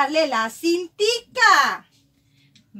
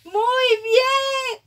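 excited praise; 6 semitones higher, 4 dB louder, much slower than usual. Real, not acted. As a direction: celebrate the right answer out loud. Teacher praising child's reading. (elogio alto y entusiasta)

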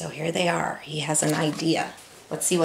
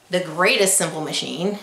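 ordinary delivery, nothing marked

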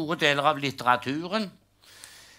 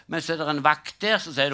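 usual speaking voice

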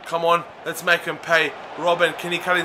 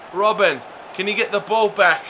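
normal talking voice

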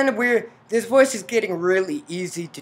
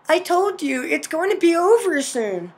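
higher female character voice; mother character; 10 semitones higher, 5 dB louder than usual. Acted. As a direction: voice the mother lightly and high, far from a villain's growl. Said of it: "that was Mother talking".

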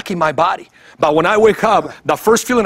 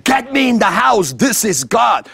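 insistent shout; 6 semitones higher than usual. Retold or imitated. Insisting against friends' objections.

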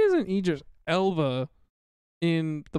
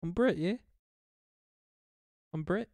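put-on Liverpool accent; 4 dB quieter than usual. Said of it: "now you're going to Liverpool".

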